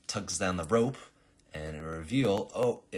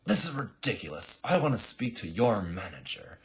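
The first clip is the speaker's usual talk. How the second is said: loud, higher indignant complaint; entitled Karen; 5 semitones higher, 8 dB louder than usual. Acted. The Karen assassin complains to the herald, loud and indignant. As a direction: demand the manager, affronted. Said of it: "Karen goes".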